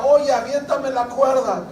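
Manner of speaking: mock call for help across a distance; retold shout. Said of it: "el que se está ahogando le grita". (grito imitado pidiendo ayuda)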